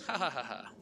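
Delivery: amused cackle at someone's expense; mocking laugh. Real, not acted. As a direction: laugh out loud at your own jibe. Laughs at dull character.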